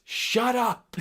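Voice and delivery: high, sudden imitated shout; coach's yell. Coach silencing the arguing player, imitated yell. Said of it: "and he goes".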